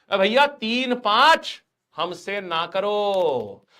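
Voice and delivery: high, slow, stretched pleading voice; drawn-out mock plea. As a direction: drag out the plea like a whining villager. Mock plea not to quarrel.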